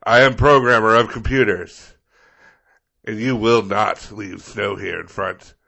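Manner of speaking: loud, portentous proclamation, easing off at the end; Gandalf-style resident. Acted. Resident vowing revenge on plow driver, grand declamation. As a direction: declaim it like an epic hero. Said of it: "giving their best Gandalf".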